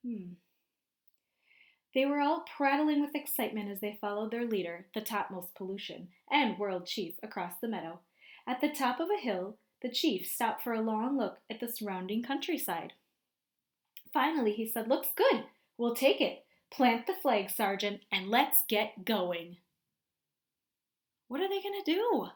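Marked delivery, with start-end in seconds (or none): 1.9-14.9 lively storybook narration for a class; picture book read-aloud voice; reading to children. A narrator's reading. 14.9-19.6 loud commanding chief barking orders; a loud, pleased conqueror claiming a new world; bossy alien chief. Acted.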